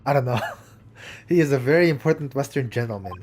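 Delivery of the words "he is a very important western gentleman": put-on lightly Russian-accented gruff crewman; proud Russian sailor, a touch louder; mild Russian accent, stiff and proud.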